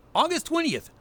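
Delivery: ordinary delivery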